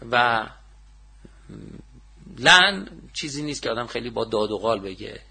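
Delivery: normal talking voice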